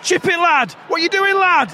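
loud, high frustrated yell; shouting at player. Real, not acted. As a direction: yell at the striker on screen.

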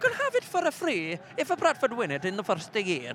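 put-on a light, high Italian accent with a touch of Welsh twang; Bella from Fireman Sam. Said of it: "I went a little bit Bella from Fireman Sam".

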